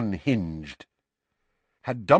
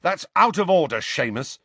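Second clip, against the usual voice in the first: firm, raised-voice reproach; mounting anger; 7 semitones higher, 4 dB louder than usual. A narrator's reading. Ron reproaches Seamus for insulting Harry, anger mounting. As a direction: tell him off with a touch of anger. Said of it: "ears were starting to glow red".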